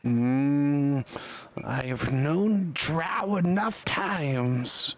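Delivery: menacing little creature, dragging every word; golem. Acted. Golem distrusts the drow browsing his shop, menacing golem voice. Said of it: "now he's pointing at you menacingly".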